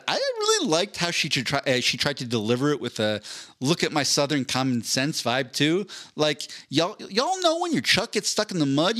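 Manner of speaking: hammed-up Southern twang mocking the congresswoman; Southern accent. Podcast host reading his tweet in a mock Southern accent. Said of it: "In my head, it was in a Southern accent".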